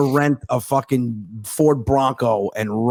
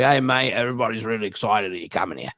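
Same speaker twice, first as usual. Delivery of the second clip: mate's Australian phone voice; Australian accent; 3 dB quieter than usual. Retold or imitated. Australian comic welcoming a visitor, Australian accent. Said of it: "It's a good Australian accent".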